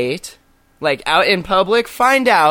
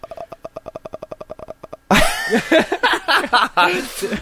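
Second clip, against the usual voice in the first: long laugh; 9 semitones higher than usual. Real, not acted. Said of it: "Okay, that's too much".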